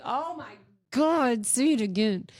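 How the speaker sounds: high, slow smitten plea; swooning. Acted. Woman hears the Englishman's accent, swooning. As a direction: swoon slowly over his accent.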